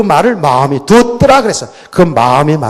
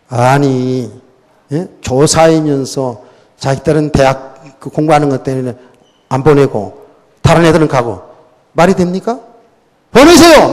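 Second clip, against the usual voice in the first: blunt scolding; 2 semitones lower than usual. Retold or imitated. Youth leader confronts a fellow teacher about his daughter, blunt scolding. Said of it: "돌직구였어요, 완전히 진짜".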